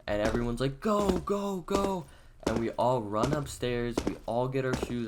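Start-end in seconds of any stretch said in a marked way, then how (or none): 0.8-2.1 much higher mock-panicked calls; urgent urging. Friends fleeing the cops, retold urgent urging. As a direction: urge everyone out, imitating the rush.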